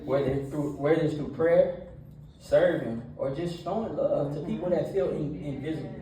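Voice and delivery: energetic church talk; preaching. Acted. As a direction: preach it to the young crowd with energy. Youth sermon with retold story.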